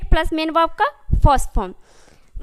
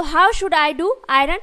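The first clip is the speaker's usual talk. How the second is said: very slow, word by word; slow, careful model sentence; slow dictation; much slower than usual. Shown as a demonstration. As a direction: spell it out slowly for the class. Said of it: "teacher models the English sentence".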